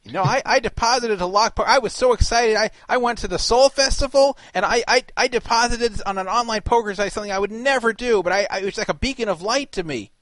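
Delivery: high, loud, overexcited convert; gushing testimonial voice. Pretend. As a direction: gush like a thrilled customer in an ad. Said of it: "get Dave Letterman on there and say".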